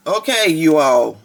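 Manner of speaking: normal talking voice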